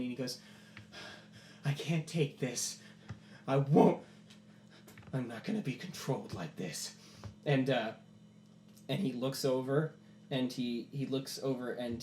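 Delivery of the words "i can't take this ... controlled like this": strained, anguished defiance; pained outcry. Acted. Percival on his knees under the sky, pained. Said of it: "yelling up into the sky in pain".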